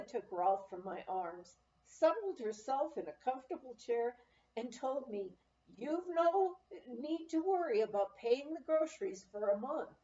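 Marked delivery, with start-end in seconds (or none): 5.8-9.9 a warm-hearted small-town grocer; higher, motherly shopkeeper voice; kindly grocer woman. A narrator's reading. Narrator voices grocer Irma.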